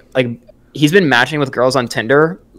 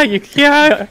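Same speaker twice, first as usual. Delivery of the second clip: mock voice crack, jumping way higher; 14 semitones higher than usual. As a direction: squeak the answer like a nervous kid. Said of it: "With the voice crack and everything?".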